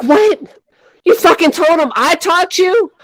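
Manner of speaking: high, incredulous outburst; shocked disbelief. Retold or imitated. Teller learns her friend named her as teacher, shocked. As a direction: retell it as appalled disbelief.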